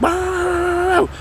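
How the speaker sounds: high, drawn-out imitated howl; mock dog bark. Frustrated dog on the end of the leash, high mock yowl. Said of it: "temper tantrum on the end of the leash where he's like".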